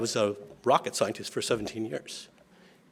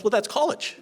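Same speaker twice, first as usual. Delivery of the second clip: high, knowing big-sister voice, mock-explaining; imitating big sister; 12 semitones higher than usual. Big sister telling her little brothers, high-pitched and matter-of-fact. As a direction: play the older sister who has the answer, pitched high.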